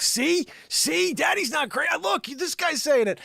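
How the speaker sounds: high, loud vindicated exclaim; triumphant told-you-so to son; excited. Retold or imitated. Telling his son "see?.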